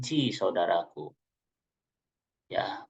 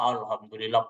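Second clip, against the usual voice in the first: grateful sigh; 3 semitones lower, 4 dB quieter than usual. Acted. Grateful husband voiced.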